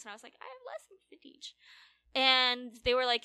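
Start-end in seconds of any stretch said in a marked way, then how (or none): 0.4-1.5 high, soft retold excuse; meek protest. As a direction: protest meekly in a small high voice. Retells pleading off work to manager.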